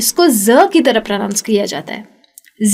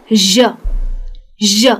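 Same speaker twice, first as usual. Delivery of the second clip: slow demo sound; 3 semitones lower than usual. Shown as a demonstration. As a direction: linger on ज as a gentle zh buzz. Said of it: "ये थोड़ा सा अलग है".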